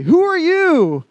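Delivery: high, loud, stretched-out challenge; challenging shout. Retold or imitated. Moses' voices challenging God, challenging shout. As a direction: throw the question out at full volume.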